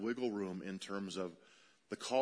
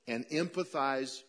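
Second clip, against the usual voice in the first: usual speaking voice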